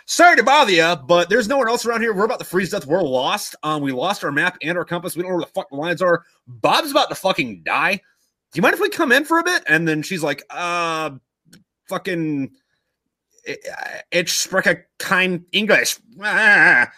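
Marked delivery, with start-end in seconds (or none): 0.1-9.6 high, rising plea to be let in; acted-out soldier pleading at the door; urgent polite plea. Lost soldiers beg to come in. 10.5-16.9 slow, stumbling attempt at German; drawn-out, unsure foreign phrase; halting language struggle. German woman struggles for words.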